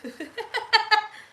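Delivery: high, loud giggling laugh; real laugh. Real, not acted. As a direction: burst into a high cackle. Laughing after teasing her mother.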